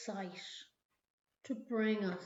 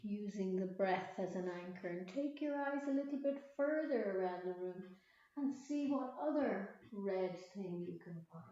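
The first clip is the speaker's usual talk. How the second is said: guided meditation; 6 dB quieter than usual. Acted. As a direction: ease them back to the breath. Leads a mindfulness meditation.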